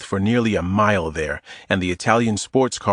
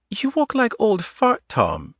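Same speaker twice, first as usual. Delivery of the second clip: put-on higher woman's voice with a light Eastern European accent; 12 semitones higher than usual. Adita teasing Tom in the grocery aisle, Eastern European accent. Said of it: "In a light Eastern European accent".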